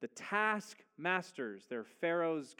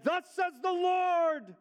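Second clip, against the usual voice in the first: "Thus says the Lord" announced much higher and louder, slowly, full of gusto; bold Moses; 14 semitones higher, 7 dB louder, slower than usual. Retold or imitated. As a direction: Moses bursting into the court, proclaiming boldly. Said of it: "he came in all bold and full of gusto".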